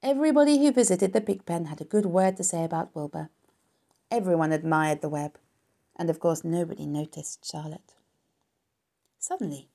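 ordinary delivery

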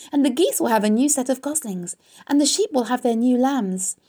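loud, rushing reassurance; excited insistence. A narrator's reading. Wilbur cheers Charlotte about next spring, loudly excited. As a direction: build from protest into exuberant, high cheer.

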